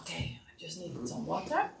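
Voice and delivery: whispered, half-voiced aside; a hushed aside about needing water. Real, not acted. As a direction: drop to a whisper for a quick aside. Aside while massaging.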